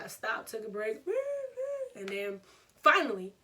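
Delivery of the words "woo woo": imitated distant holler; thin, high mock wail; mock yelling. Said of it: "doing all of that".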